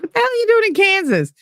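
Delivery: high-pitched, rushed mock outrage; retold outrage. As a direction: imitate an incredulous ex, voice shooting way up. Retold outraged father on phone.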